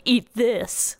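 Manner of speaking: lightly voiced teenager; a hint of teen attitude; teenage voice. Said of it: "like, the teenage voice".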